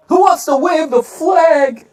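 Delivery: high, slow hype-man owner; cheesy team owner. Retold or imitated. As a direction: egg the crowd on like a smarmy host. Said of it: "rubbing their balls every game".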